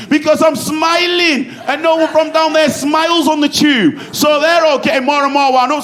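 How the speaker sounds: bursting with the joke; excited. Real, not acted. Comic to crowd, gleefully loud about smiling on the tube.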